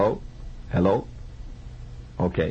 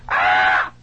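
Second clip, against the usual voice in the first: loud imitated scream; fantastic scream; 8 semitones lower, 5 dB louder than usual. Storyteller mimics a patient behind the door, loud scream. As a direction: cry out in one loud burst. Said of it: "fantastic scream".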